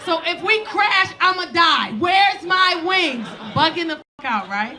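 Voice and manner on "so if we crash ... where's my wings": loud high shout, drawn out; shouted high panic; panicked shouting. Retold or imitated. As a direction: scream it like the plane is going down. Freaking out on plane.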